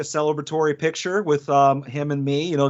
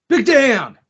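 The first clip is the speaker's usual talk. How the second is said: high, loud call falling away on the name; hyped name shout; 8 semitones higher, 7 dB louder than usual. Acted. Co-host hailing the friend joining the car ride, hyped shout. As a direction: holler it out like a hype man.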